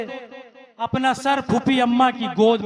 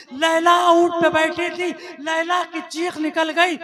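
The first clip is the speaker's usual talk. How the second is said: rising wail; 4 semitones higher than usual. Acted. Majlis recitation rising to a wail.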